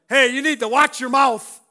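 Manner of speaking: loud, high-pitched telling-off; mock scolding shout. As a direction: shout it like an indignant reprimand. Acts out a scolding he avoids.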